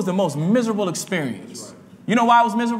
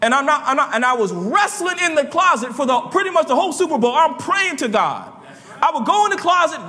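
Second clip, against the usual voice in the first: fiery preaching; 8 semitones higher than usual. Acted. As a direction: preach it out to the church with fire. Preaches at full force.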